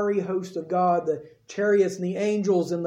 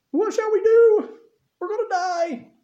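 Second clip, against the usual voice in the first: very high, louder cry of panic; panicked soldiers; 12 semitones higher, 4 dB louder than usual. Acted. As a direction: shriek in mock terror. Syrians realise they're trapped.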